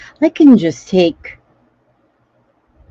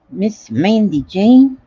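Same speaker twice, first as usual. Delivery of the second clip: slow stretched Southern "Miss Mandy Jane?"; lightly Southern, slow and warm; Southern drawl; 4 semitones higher, slower than usual. Pretend. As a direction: drawl the name slowly, Southern style. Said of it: "That's Southern talk".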